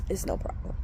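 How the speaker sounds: very soft, voiceless, holding back tears; hushed and tearful; choked up. Real, not acted. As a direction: barely get the words out through emotion. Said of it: "I started crying".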